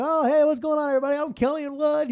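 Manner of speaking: mock emcee voice, pitched well up; cheesy host greeting. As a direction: play the chipper radio host greeting the crowd. Retold stage greeting.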